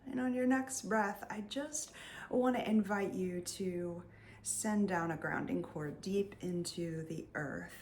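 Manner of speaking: usual speaking voice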